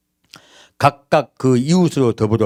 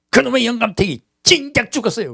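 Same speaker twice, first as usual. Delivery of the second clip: put-on high, bitter old woman's voice; imitating a bitter old grandmother; grumpy grandmother; 10 semitones higher than usual. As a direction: spit it out like a resentful granny. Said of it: "그러더라고".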